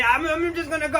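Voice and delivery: high, hurried retreat; sheepish exit. Acted. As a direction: slip away awkwardly. Collector trying to leave.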